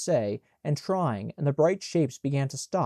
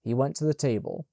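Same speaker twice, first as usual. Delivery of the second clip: ordinary delivery, nothing marked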